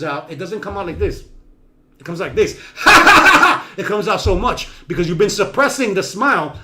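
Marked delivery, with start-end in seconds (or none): 2.8-3.7 burst of laughter. Shown as a demonstration. Demoing a burst of smile.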